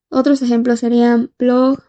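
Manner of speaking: ordinary talk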